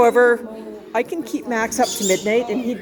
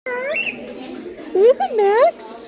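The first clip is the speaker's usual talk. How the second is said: talking to a dog; much slower than usual. Real, not acted. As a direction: croon it up high to the dog. High slow voice to the dog.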